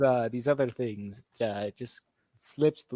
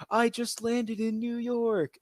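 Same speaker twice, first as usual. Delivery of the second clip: mock tourist voice; 9 semitones higher, 3 dB quieter, slower than usual. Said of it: "retelling a viral video".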